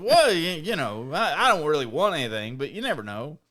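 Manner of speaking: high, wavering dad impression; sing-song hemming and hawing as dad; imitating dad. Retelling dad's excuse for the auction, high wavering voice. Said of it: "And he said".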